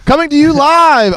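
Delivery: loud, high show opener; announcer voice. Acted. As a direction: boom it out like a host.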